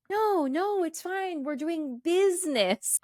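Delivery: high-pitched, singsong brushing-off of worries; Renfield. Retold or imitated. Renfield waving off the warnings, mock-breezy and high. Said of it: "Renfield is just like".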